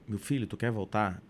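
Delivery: low, soft retold question; gentle mother. Mother asking her son about returning, gentle. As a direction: imitate a mother softly offering a way out.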